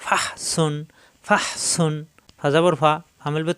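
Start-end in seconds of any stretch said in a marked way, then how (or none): 0.0-1.9 ফাহ ending in a strong airy h, then a sharp s in সুন; pressed throat h. Shown as a demonstration. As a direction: squeeze breath through ফাহ before সুন. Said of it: "হা কণ্ঠনালীতে চাপ দিয়ে". (ফাহ-এর হা কণ্ঠনালীতে চাপ দিয়ে)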